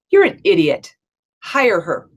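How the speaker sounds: firm wife. Retold or imitated. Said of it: "as every strong woman would do".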